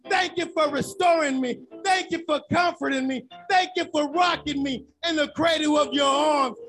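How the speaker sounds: shouted, swelling praise; getting excited. Real, not acted. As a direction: let the joy carry you away in thanks. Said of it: "I'm getting excited".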